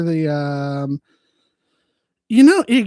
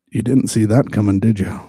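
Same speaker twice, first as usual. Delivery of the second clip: much lower, louder, teasing; smug low taunt; 9 semitones lower, 5 dB louder than usual. Acted. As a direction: drop the voice low and gloat. Smug aside after gibberish.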